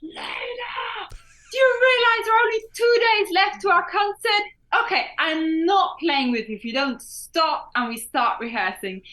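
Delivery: high, loud exasperated scolding; half-crying, shouting at her sister; mock nagging shout. Sister scolds Layla about the concert, high, loud mock shout. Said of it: "she's like".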